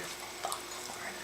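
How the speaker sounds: barely voiced, slow whispered curse; real exasperated whisper; under the breath. Real, not acted. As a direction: swear almost silently. Swearing under breath about Florida.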